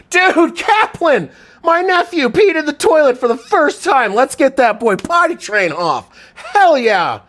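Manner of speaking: high, loud excited cheering for the nephew; joyful shout. Real, not acted. Uncle celebrating his nephew's first potty success, loud cheer.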